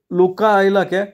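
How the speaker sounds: high-pitched, taken aback; startled shopkeeper's query; surprised question. A narrator's reading. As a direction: ask it high, caught off guard. Shopkeeper surprised that Luka is back.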